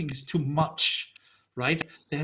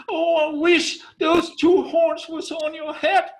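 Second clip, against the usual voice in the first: loud, high furious curse; angry outburst; 13 semitones higher, 10 dB louder than usual. Retold or imitated. Husband cursing his wife in anger, shouting. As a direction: snap it at her in a rage. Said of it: "so angry with her that he just said".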